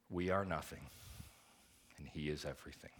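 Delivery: soft, low preaching; a pastor letting the truth sink in; hushed awe. Acted. Hushed sermon.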